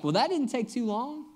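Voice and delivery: breezy, upbeat retelling of Dad's words; cheerful dad. Retold or imitated. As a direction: chirp it like a pleased father. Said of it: "very cheerfully, he's just like".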